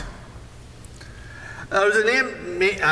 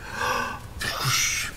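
gasp; 8 semitones higher, 6 dB quieter than usual. Retold or imitated. Dunking in cold dirty water.

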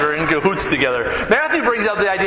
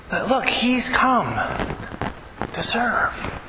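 slow, fading to a whisper on serve; hushed emphasis; 3 semitones higher, 4 dB quieter, slower than usual. Real, not acted. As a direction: let it drop to a whisper. Preacher hushes the key point.

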